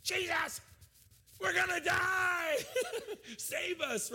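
high, loud, frantic yell breaking into a laugh; panicked shout. Retold or imitated. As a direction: scream it in his face like Peter. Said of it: "Peter just right in his face like".

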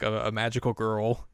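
ordinary talk

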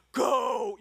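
high, forceful shout; loud aggressive voice. Acted. God imagined ordering a church plant, loud and aggressive. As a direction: bellow it like a booming command. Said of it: "loud, aggressive voice".

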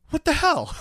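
high, indignant husband impression; mock indignation. Husband reacting to being inconvenienced, high mock indignation. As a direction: pitch it up in comic outrage.

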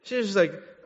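usual speaking voice

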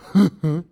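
short burst of laughter; brief loud laugh. Real, not acted. Preacher laughing at his own aw-shucks bit. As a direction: laugh at the silly image.

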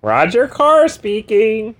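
high, slow phone greeting; high Carr voice answering the phone. Acted. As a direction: answer the phone like a pompous loser.

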